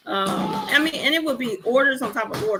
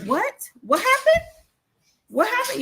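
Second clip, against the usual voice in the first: high pretend panic, much higher; faked alarm; 13 semitones higher than usual. On a fake call to fool the date, high mock alarm. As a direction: fake a family emergency on the phone. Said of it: "I just faked it".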